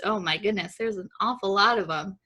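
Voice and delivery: usual speaking voice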